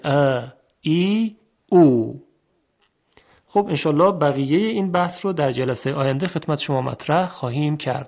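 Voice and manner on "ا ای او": slow, drawn-out vowels ا، ای، او; long vowels. Shown as a demonstration. Teacher summing up long vowels, each stretched. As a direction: hold ا، ای، او long, one by one. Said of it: "صداهای بلند و کشیده".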